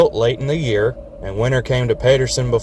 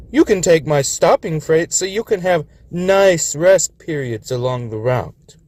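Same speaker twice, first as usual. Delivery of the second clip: higher, puffed-up storyteller voice; boastful old engine; 6 semitones higher than usual. A narrator's reading. Governor bragging to his little brother, puffed-up and higher. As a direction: brag about the cold winters.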